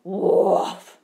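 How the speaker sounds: a loud bear woof; loud bear roar. A narrator's reading. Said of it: "roared the big brown bear".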